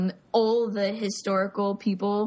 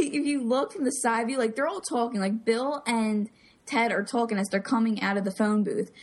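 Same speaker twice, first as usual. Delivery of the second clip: ordinary delivery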